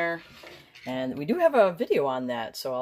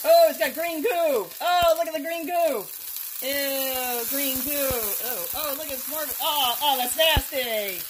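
grossed-out squeal; 11 semitones higher, 6 dB louder than usual. Real, not acted. Green goo comes out, disgusted.

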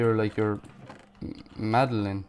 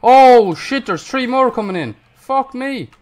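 loud, high panicky yelling; alarmed shouting; 11 semitones higher, 13 dB louder than usual. Real, not acted. Player seeing three more coming in, alarmed shouting. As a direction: shout in alarm as things get worse.

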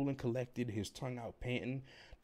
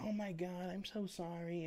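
mocking, high-pitched apologetic owner; goofy dog owner; 11 semitones higher than usual. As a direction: put on a silly high voice for a gushing apology. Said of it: "just dumb and goofy as hell".

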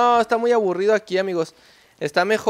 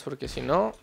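usual speaking voice

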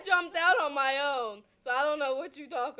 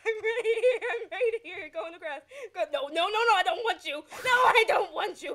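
raised, laughing, urgent yelling; frantic protest; 5 semitones higher, 5 dB louder than usual. Real, not acted. Player turning away the wrong driver, frantic protest. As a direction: repeat it in laughing panic.

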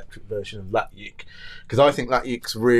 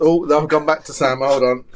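ordinary talk, nothing marked

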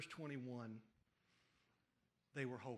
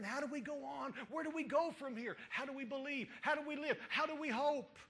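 anguished questions; 8 semitones higher, 4 dB louder than usual. Acted. Grieving people cry out questions.

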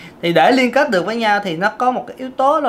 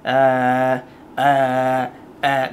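low, full chest-voice à; 6 semitones lower, much slower than usual. Shown as a demonstration. Vocal coach showing the chest voice to link. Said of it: "bạn phải chơi giọng này nè".

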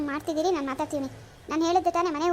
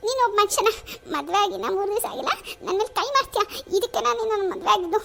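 outraged; 4 semitones higher than usual. Acted. New bride confronts husband who threatened her, outraged and shouting. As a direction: hurl it back at him, appalled.

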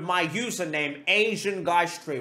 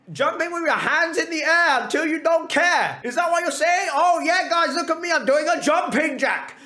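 higher-pitched mock enthusiasm; mocking imitation; 8 semitones higher than usual. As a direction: tease with an exaggerated, higher voice. Mockingly acts out the explanation.

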